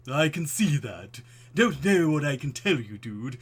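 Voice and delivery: loud, slow, put-on bored cashier; a misfired character voice; wrong voice for the cashier. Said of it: "it's the wrong voice".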